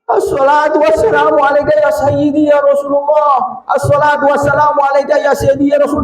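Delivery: high, impassioned trembling cry; trembling salawat. Retold or imitated. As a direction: call out the greeting overcome with emotion. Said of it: "Dengan bergetar".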